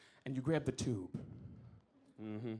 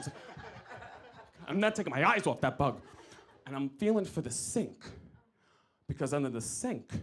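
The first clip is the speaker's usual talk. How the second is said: usual speaking voice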